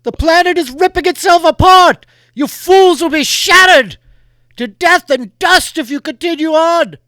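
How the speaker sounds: high, very loud echoing warning; masked rider booms a warning; booming rider warning. Acted. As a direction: shout the doom across space. Rider warns the crew.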